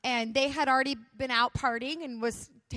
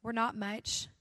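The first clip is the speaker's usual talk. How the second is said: simple prayer; 6 dB quieter than usual. Retold or imitated. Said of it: "saying this simple prayer".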